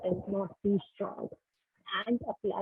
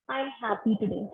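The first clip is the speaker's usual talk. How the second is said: ordinary delivery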